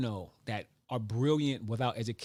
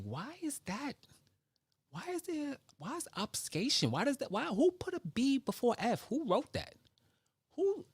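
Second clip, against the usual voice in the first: high squeaky mocking voice, far higher; mock confused squeal; 13 semitones higher than usual. Mocking the English language in a high squeaky voice. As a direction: play the baffled asker in a high squeak.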